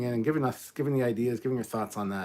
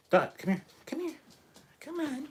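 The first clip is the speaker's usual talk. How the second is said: high sing-song dog call. Real, not acted. Calling the pet dog over to the camera, high coaxing call. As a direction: coax the dog over in a much higher voice.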